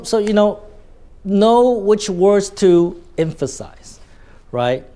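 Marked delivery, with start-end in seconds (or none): 1.2-4.0 words and emphasize stretched, slow and high; deliberate emphasis. Shown as a demonstration. Teacher urging students, slow high stress on each word. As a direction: spell out each key word slowly, emphasize drawn out.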